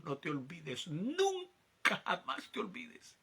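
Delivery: slow, solemn divine voice, lightly voiced; a hint of a solemn God, slow; voice of the Lord. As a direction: speak as God reminding him of his calling. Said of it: "Y me dijo".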